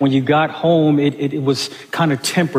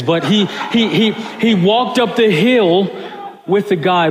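laughing while telling; 6 semitones higher than usual. Real, not acted. Pastor retelling a boy's kindness to the congregation, laughing. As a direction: chuckle at the memory as you speak. Retelling a touching kind act.

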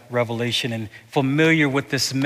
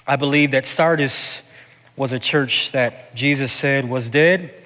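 normal talking voice

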